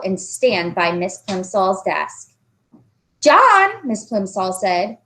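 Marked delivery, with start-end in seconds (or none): 3.2-3.7 sharp, loud call of a teacher; stern teacher. Acted. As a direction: call the boy to the desk. Teacher calling him.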